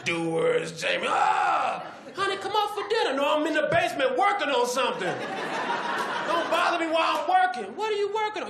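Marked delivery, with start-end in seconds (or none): 1.1-1.8 high angry shout; mock frustrated scream; frustrated yell. Inventor failing again. 2.2-3.1 very high call from upstairs; high wife's voice. Acted. Wife calling the inventor. 3.1-7.8 high loud snap; shouting at the wife; irritated husband. Acted. Inventor snapping at wife. 7.8-8.5 very high woman's voice; high wife; wife's voice. Acted. Wife asking.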